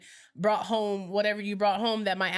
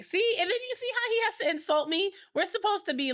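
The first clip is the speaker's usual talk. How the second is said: exasperated complaining; 10 semitones higher than usual. Real, not acted. Wife complaining to audience.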